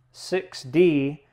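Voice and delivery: ordinary talk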